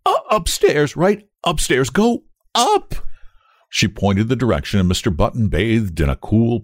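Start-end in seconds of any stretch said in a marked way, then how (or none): 0.1-2.9 high, stammering panic; hysterical. A narrator's reading. Terrified nurse sends father upstairs, hysterically. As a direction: squeak out directions in hysteria. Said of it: "she cried hysterically".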